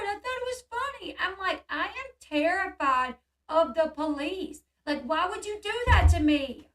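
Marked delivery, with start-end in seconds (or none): 0.1-1.2 high-pitched mimicry of an excuse; mock defensive. Sean excusing his prank to her, mock defensive. 1.7-6.7 high, slow, emphatic protest; retold indignation, louder toward the end; upset rebuke. Woman scolding Sean for the police prank, upset. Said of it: "I was mad".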